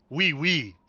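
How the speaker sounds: a jokey French "Oui, oui"; tossed-off fake French accent on "Oui"; mock French. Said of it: "Loves my, my French".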